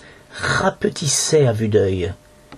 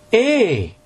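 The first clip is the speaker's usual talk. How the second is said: high sudden "Hé!"; a startled yelp, lightly done; surprised exclamation; 9 semitones higher than usual. A narrator's reading. Boy startled as the pendant slips, a high quick cry. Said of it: "m'exclamai-je, surpris".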